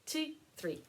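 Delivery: ordinary delivery, nothing marked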